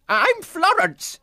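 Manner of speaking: very high, bossy clucking hen voice; clucking nurse chicken. Acted. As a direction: bustle in like a fussy hen nurse. Florence introduces herself.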